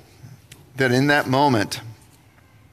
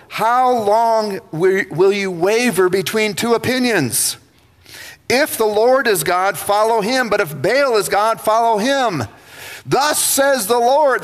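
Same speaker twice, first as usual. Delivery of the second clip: raised, emphatic preaching to a crowd; prophet's challenge; 5 semitones higher than usual. Acted. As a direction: call the people out, high and forceful. Elijah preaching to the people.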